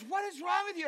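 high, loud demand; exasperated yell. Retold or imitated. Wife to babbling husband, exasperated yell.

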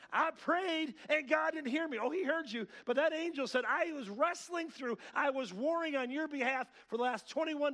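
loud, high, full-force pulpit delivery; impassioned preaching. Real, not acted. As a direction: preach it to the back row.

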